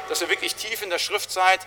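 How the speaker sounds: ordinary delivery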